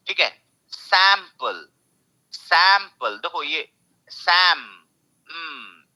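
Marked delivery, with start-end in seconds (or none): 0.7-3.2 "sample" with punched SAM and short pul; stress on first syllable. Shown as a demonstration. Teacher models the word for students, sample stressed on SAM. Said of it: "you have to stress this one". 4.1-4.6 "सैम" held alone as the stressed syllable; syllable break. Shown as a demonstration. Teacher shows the syllable break, सैम said on its own. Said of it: "यहाँ पे break हो रहा है".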